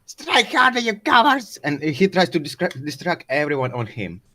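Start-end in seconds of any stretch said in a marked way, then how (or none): 0.1-1.6 high, raised battle cry; acted defiant shout; yelling taunt. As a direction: goad the enemy with a pitched-up yell. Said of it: "Japs yells out".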